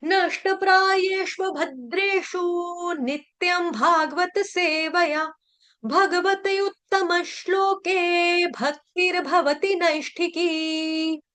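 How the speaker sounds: verse recitation. Acted. Teacher recites a Bhagavatam verse to devotees. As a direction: recite the shloka slowly and solemnly.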